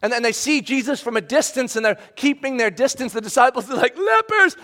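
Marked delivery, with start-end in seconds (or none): 4.0-4.5 sharp high alarmed cry of warning. Retold or imitated. Disciples warning Jesus as lepers approach, startled high cry.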